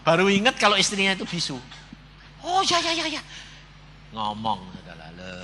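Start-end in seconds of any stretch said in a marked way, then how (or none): 2.5-3.2 high-pitched run of yeses; sudden realisation. Acted. Husband recalls his wife is mute, high excited realisation. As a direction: play it dawning on you in a high voice.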